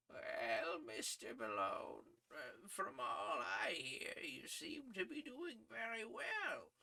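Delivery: lightly voiced kindly old editor, slow and high; a gentle older editor drawing out his praise; kindly old Scot. A narrator's reading. Old news editor praising his young reporter, lightly voiced. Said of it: "in his kindly Scotch accent that I cannot replicate".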